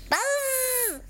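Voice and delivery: high, soft buzzy fly voice; mad fly buzz. Acted. Trapped fly protesting to the boy, high soft buzz. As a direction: squeak it out like an angry little fly. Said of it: "He stamped his foot and said".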